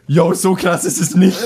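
laughing through a retold quote; can't keep a straight face while quoting. Lying to the vice principal, laughing. (lachend, kaum ernst)

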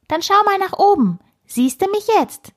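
very high, playful piping; squeaky squirrel voice. A narrator's reading. Hidden squirrel tells the girl to look up, squeaky. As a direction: call out in a tiny high voice.

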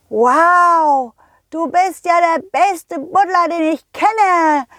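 put-on high, amazed child's cry; thrilled little boy exclaiming; pirate's excited call. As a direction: call out in awe as a little pirate, drawn out at the end. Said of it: "rief der Pirat laut".

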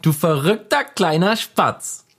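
put-on high, slow childish voice; Infantino voice. Teasing his friend, as a slow squeaky child. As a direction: coo it like a cartoon kid. Character voice.

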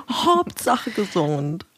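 drawn out very slowly, teasing. Pretend. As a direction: stretch it out to tease.

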